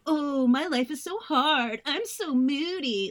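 high, loud mock self-pity; mocking whine. Parents making fun of their moody kid, high mocking whine. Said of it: "when your parents made fun of you".

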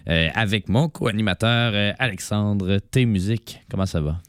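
usual speaking voice

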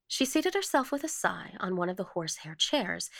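ordinary delivery

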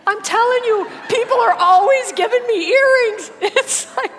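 high, loud and delighted; bursting with joyful disbelief; excited. Real, not acted. Excited testimony about earrings.

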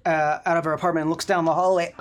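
ordinary talk

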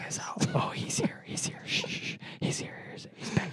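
urgent hushed warning; worried whisper. Pretend. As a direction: whisper that he has arrived. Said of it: "Why are we whispering?".

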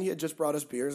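normal talking voice

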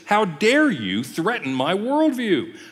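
high-pitched, indignant imitation; mock angry Elihu. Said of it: "Elihu just gets mad at him".

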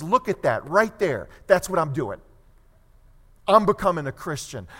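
loud, decisive announcement; acted-out convert's emphatic resolve; resolute declaration. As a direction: declare it with sudden conviction. Acts out Augustine announcing his conversion.

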